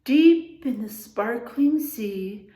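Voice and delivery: slow, loud storybook opening; reading to children. A narrator's reading. Teacher opens the picture book for the class, slow storybook voice. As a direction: draw the class into the story slowly.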